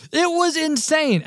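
high, slow, emphatic amazement; stretched-out excited exclamation; real excitement. Real, not acted. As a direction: exclaim it at the story's peak. Storyteller at the story's climax.

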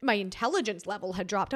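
normal talking voice

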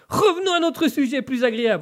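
sudden high-pitched surge; high, forceful host's segue; rising in power. Real, not acted. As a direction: power up to change the subject. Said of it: "petite montée de puissance".

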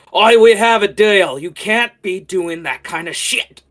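loud, high barked protest; Coldbeard. Acted. Coldbeard barks at Roy over their broken deal, indignant. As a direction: bark it out, indignant and full-voiced. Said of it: "bark at each other".

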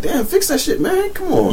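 very high squeaky complaint; high-pitched fake outrage; mock exasperation. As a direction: whine at the baby.

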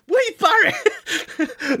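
a laughing, incredulous yelp of the name; laughing disbelief. Real, not acted. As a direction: repeat the name high and slow through a laugh.

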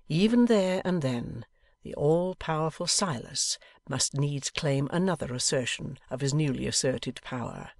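ordinary delivery, nothing marked

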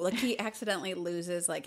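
ordinary talk, nothing marked

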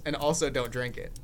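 normal talking voice

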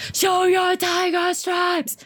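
high, drawn-out yell; loud, high mob shout; crowd shouting. Acted. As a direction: jeer it loudly and slowly. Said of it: "the crowd shouted in flame".

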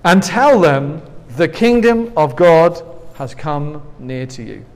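loud, slow verse proclamation; measured Bible reading, easing down at the end; scripture reading. Acted. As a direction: read it out deliberately, projecting at the start. Reads scripture aloud.

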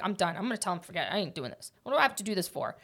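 lightly acted giving up, under the voice; inner grumble. Athlete talking to herself at set seven, quiet inner grumble. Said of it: "But in my head".

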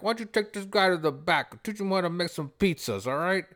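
high-pitched imitation of a New York Italian boss; New York Italian pizzeria owner giving orders; amateur New York Italian accent. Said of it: "in a New York accent, which I'm probably not too good at".

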